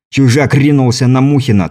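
ordinary delivery

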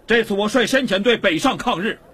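high-pitched, ringing speech to a crowd; impassioned public oratory. Acted. Prisoner appealing to his countrymen, impassioned oratory. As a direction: proclaim it passionately across the street.

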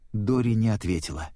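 plain reading voice

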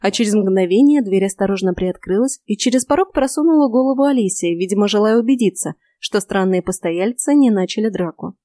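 ordinary delivery

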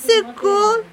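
plain reading voice

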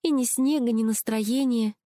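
high, dejected complaint; sad. A narrator's reading. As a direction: lament it high, slowing at the end. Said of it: "грустно сказала она".

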